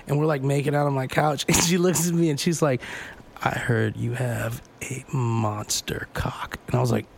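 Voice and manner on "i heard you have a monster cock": low, slow sultry purr; sexy.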